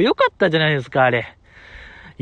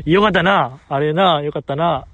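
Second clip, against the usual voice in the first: ordinary talk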